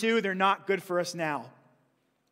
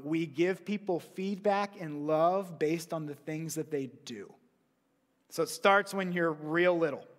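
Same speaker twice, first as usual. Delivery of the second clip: normal talking voice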